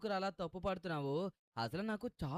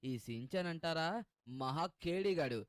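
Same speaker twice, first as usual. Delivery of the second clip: ordinary delivery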